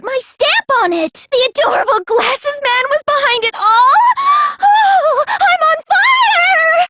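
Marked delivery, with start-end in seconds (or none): 4.6-6.9 gasp, then a slow, high swoon of delight; thrilled gasp. Acted. Killer swoons over the reveal to classmates, gasping in thrilled delight.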